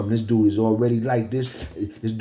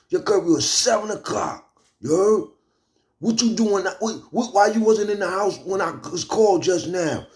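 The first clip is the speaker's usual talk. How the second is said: high-pitched scolding, imitating an angry parole officer; mock screaming; 10 semitones higher than usual. Parole officer berating him over curfew by phone, high mock scream. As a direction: mimic a hectoring yell without full volume. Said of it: "screaming on the phone".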